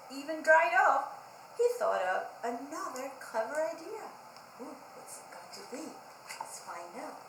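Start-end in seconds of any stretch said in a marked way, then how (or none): 4.6-7.2 soft teasing question; hushed suspense. Shown as a demonstration. Teacher hushing kids before the next idea. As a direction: tease the kids with a secret. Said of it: "teacher teasing suspense".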